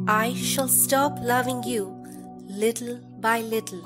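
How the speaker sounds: ordinary delivery, nothing marked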